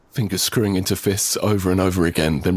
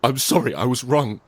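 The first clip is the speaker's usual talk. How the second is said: higher, broken desperate plea; desperate pleading; 11 semitones higher than usual. Acted. Narrator pleads with Lena for his life, desperate. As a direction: beg for your life, stumbling.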